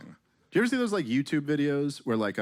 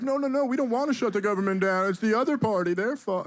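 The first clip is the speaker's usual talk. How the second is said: high, defensive politician whine; mock politician; 8 semitones higher than usual. Politician on the news dodging blame, high and whiny. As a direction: squeal it like a cornered politician. Politician blaming other party.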